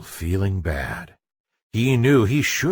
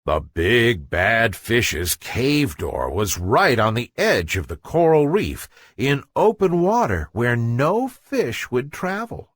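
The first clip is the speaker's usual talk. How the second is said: ordinary delivery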